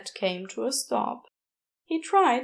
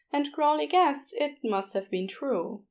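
plain reading voice

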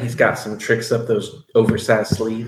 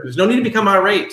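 sharp, louder and higher customer-service scold; raised voice, firmly polite; 6 semitones higher, 4 dB louder, faster than usual. Acted. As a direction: push back on the irate caller. Clerk scolds angry customer.

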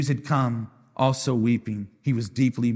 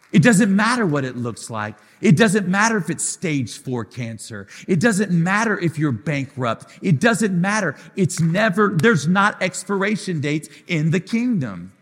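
higher and louder, hammering each "doesn't"; preaching at full force; 5 semitones higher, 5 dB louder than usual. Acted. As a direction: build it like a pulpit climax. Preaching crescendo on doesn't matter.

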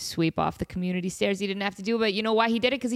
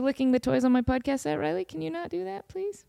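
gentle scolding; 6 semitones higher than usual. Real, not acted. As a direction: gently ask the dog to stop.

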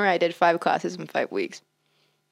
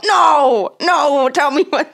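loud, high, slow angry shout; angry skeleton; 7 semitones higher, 7 dB louder, much slower than usual. Retold or imitated. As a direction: yell it like an offended skeleton. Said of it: "the skeleton gets really pissed off".

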